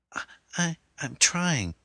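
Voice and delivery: high, stammering terrified voice; stammering in fear. Acted. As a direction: stumble over the words, scared.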